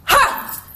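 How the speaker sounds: loud, high explosive ha; a sudden shout to break the grip; sharp shout. Retold or imitated. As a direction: blast the breath out in one burst. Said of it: "And then I went".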